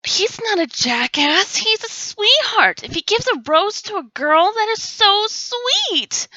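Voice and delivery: high, slow swooning gush; gushing fangirl. Retold or imitated. Girls in the audience defending him, high gush. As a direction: defend him like a smitten fan. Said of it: "most of you girls would go".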